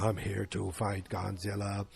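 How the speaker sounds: slow, softened robot monster; mock monster announcement, hushed and slow; Mechagodzilla voice. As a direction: intone it slowly like a giant machine. Voices Mechagodzilla arriving to fight.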